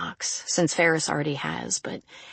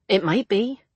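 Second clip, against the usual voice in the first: plain reading voice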